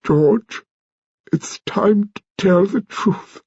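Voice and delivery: lightly voiced grand old lady; slow, stately old lady. A narrator's reading. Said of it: "she said without moving".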